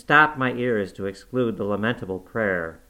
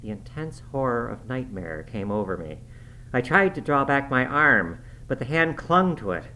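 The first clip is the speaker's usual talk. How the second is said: usual speaking voice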